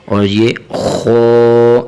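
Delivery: خو said thick and full from the throat; thick rounded خو; heavy kho. Shown as a demonstration. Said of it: "یہ حرف موٹا کر کے پڑھنا چاہیے".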